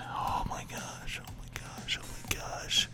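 whispered, breathy freak-out; hushed mock panic under the breath; feigned panic. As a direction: whisper it to yourself as if overwhelmed. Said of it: "I'm freaking out right now".

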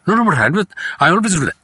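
imitating a brother's high, rushed objection; speaking too fast. Brother objecting to father's rule, speaking too fast. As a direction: jump in with a rapid, high-pitched protest. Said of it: "he used to speak very fast".

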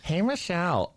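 high mock tourist greeting; squeaky out-of-town visitor.

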